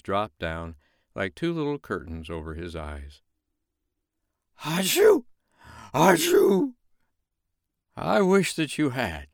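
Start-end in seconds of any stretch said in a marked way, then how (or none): 4.6-6.7 high, loud cartoon sneezes. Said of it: "the snowman sneezing as hard as he could". 7.9-9.3 high, slow snowman voice; lightly voiced snowman. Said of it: "said something outside the window".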